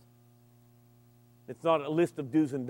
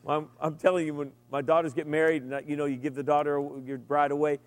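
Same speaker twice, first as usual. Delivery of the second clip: normal talking voice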